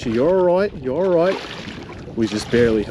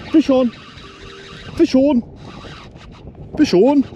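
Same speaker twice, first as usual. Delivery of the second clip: high, loud excited call of a hooked fish; 13 semitones higher, 3 dB louder than usual. Real, not acted. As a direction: call it out as the line goes tight. Fish bites, angler calls out.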